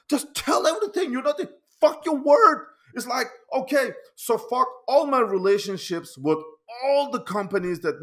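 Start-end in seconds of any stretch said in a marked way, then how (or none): 0.1-2.6 quoting the friend in a raised, heated voice; an imitated outburst, pushed up and out; mock angry quote. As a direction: throw the insult back louder and faster. Retelling friend's insult on live show.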